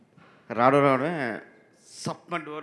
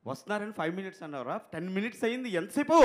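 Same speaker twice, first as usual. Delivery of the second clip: impatient director impression; 6 semitones higher than usual. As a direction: tell him off fast and sharp. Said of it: "director గారు".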